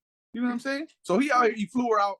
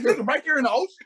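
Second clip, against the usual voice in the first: higher, louder imitation of her disbelief; mock incredulous woman; 7 semitones higher, 6 dB louder, faster than usual. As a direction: protest in a raised, pitched-up voice.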